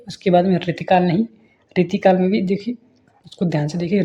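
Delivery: ordinary delivery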